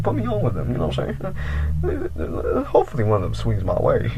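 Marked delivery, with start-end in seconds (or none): 1.8-4.1 softer, giggling whisper; hushed laughing aside. Real, not acted. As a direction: slip in a sneaky joke under your breath. Joking aside about girls.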